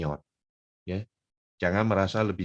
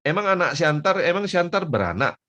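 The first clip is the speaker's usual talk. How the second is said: teasing friend; 9 semitones higher, 7 dB louder than usual. Retold or imitated. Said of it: "dia bilang".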